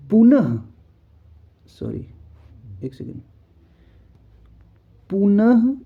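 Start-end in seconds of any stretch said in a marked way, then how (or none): none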